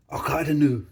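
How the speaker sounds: loud mock-Scottish catchphrase; Scottish lingo. Vlogger shows child new lingo, loud mock Scottish. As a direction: proclaim it in a put-on Scottish accent. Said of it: "I learned some Scottish lingo".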